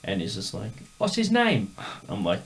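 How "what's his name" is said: high put-on Cockney question; squeaky Cockney voice asking; mock Cockney. Imitating the singer asking the fan's name.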